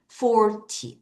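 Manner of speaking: forty with strong FOR, weak ty; FOR-ty, light short ending; stress on the front. Shown as a demonstration. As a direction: hit FOR, let ty drop short. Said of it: "앞을 강하게".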